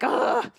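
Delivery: high, exasperated ugh; mock-frustrated ugh; frustrated groan. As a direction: groan like the spot slipped away. Losing the hosting spot.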